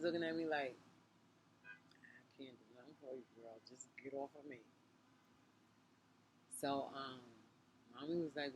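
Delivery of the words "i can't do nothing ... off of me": hushed, softer imitation of the teacher; retold scared teacher. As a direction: voice her backing off under the breath. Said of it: "She was scared".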